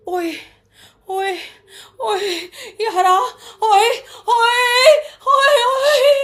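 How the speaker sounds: high oyes building to loud shouted cries; Punjabi kudi moan. Shown as a demonstration. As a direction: call oye, yara, rising to yells. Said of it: "The Punjabi kudi moan".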